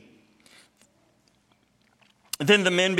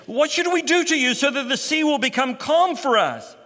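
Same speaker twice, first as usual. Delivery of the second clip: higher, louder pleading question; desperate sailors; 9 semitones higher, 4 dB louder than usual. Acted. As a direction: ask him urgently what to do. Sailors plead with Jonah in storm.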